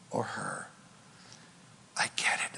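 hushed, unvoiced words; whisper. Real, not acted. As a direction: whisper it softly to them. Tender moment in sermon.

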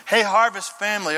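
ordinary talk, nothing marked

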